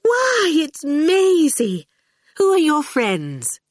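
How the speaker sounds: high, lilting sweet welcome; sweet, laughing lady. A narrator's reading. Dillion hearing Maisie at the door, sweet and merry. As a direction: greet a friend in a high, sweet voice. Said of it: "A sweet, laughing lady's voice said".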